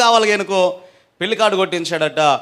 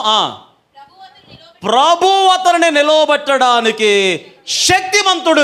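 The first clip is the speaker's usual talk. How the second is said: high, slow, drawn-out verse reading; scripture declamation; 7 semitones higher, slower than usual. Acted. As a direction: proclaim the verse to the congregation. (లేఖనాన్ని ఎత్తైన స్వరంతో ప్రకటించడం)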